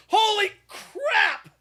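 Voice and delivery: mock-panicked exclamation; panicked outburst. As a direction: freak out about the danger for laughs. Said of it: "I'd be like".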